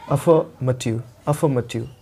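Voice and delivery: अफर्मेटिव said without the r; teacher's affirmative. Shown as a demonstration. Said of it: "इसको आपको अफर्मेटिव नहीं बोलना।".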